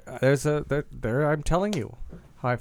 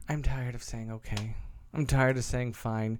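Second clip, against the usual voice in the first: tired, low and soft; drained, barely pushing it out; weary and quiet; 4 semitones lower, 7 dB quieter than usual. Real, not acted. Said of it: "I'm not fine".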